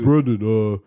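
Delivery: loud, lightly voiced dad; impression of dad. Dad greeting his son Brendan, lightly imitated. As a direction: call out like a dad on the phone. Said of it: "that sounds exactly like him".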